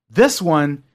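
ordinary delivery

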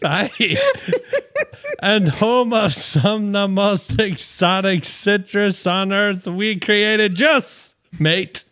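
put-on Aussie pitchman breaking into chuckles; best Australian accent. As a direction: read the can copy in broad Aussie, amused. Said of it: "Best Australian accent?".

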